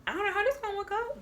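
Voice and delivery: high, fast mock-doubtful quip; doubtful aside. Thinking to herself about the line-up, high fast doubt. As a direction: toss it off, high and quick. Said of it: "I'm like".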